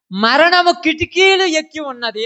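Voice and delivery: high, loud, slow proclamation; scripture declamation. Acted. Preacher quotes the warning of death, loudly declaimed. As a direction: ring the verse out slowly and high.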